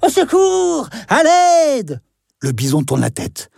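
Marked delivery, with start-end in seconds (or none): 0.1-2.0 high hammed-up wail; fake cry for help. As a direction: pretend to be hurt, calling. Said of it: "se met à gémir, comme s'il était blessé".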